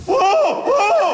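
high drawn-out hoots; bird-like hooting. Acted. Man in a tent at night, high bird-like hoots.

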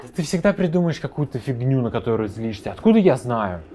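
громко и раздражённо, на повышенных тонах; отбивайся от упрёков с напором; раздражение. Acted. (раздражённо, в сердцах)

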